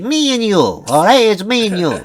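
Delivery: high-pitched cartoon Jersey mobster accent; Italian mafia guy. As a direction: swoop up high like an Italian-American gangster. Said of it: "he talks like that".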